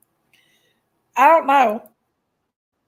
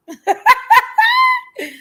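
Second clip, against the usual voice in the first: scornful shouted laugh; 4 dB louder than usual. Real, not acted. Mocking laugh mid-rant.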